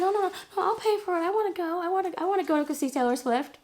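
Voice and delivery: high, hurried mock pleading; high-pitched, eager take-it-back voice; eager backtracking. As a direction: imitate someone suddenly desperate to go. Retelling her hasty change of mind.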